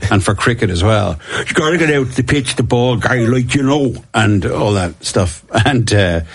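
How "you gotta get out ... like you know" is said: rugby coach. Retold or imitated. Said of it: "spoke like that".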